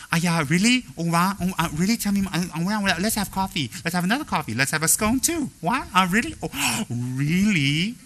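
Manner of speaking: mock fascinated gossip. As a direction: pretend to be hooked by juicy gossip. Mock eager gossip listener.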